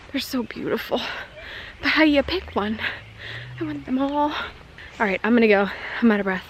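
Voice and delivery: ordinary talk, nothing marked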